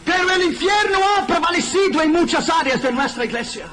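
high, loud pulpit cry; fiery warning; preaching at full force. Acted. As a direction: sound the alarm to the congregation.

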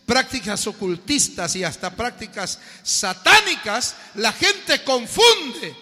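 loud, slow, high preacher's cry; a pastor thundering from the pulpit; fiery preaching. Acted. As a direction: rebuke the congregation at full force. Preaching at full force.

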